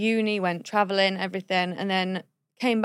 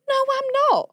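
high-pitched mock protest; indignant high retort, imitated; retold refusal; 14 semitones higher, faster than usual. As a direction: squeal the refusal, lightly acted. Retelling her refusal to clean shoe.

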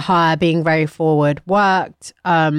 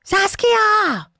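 high, ringing call of the name. Shown as a demonstration. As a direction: call the name across a room. Calling out the name.

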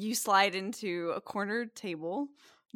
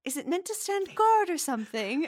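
passerby; 10 semitones higher, 3 dB louder than usual. Acted. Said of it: "someone who walks by".

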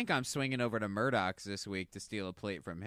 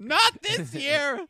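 high loud mock protest; 10 dB louder, much slower than usual. Acting family member at table.

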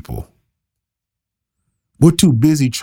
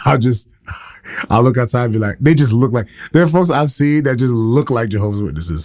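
ordinary talk, nothing marked

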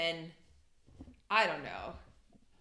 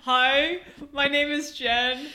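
higher, louder put-on caller voice; disguised voice; 5 semitones higher, 7 dB louder than usual. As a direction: fake a listener's voicemail in a disguised voice. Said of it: "I'll do, like, a disguised voice".

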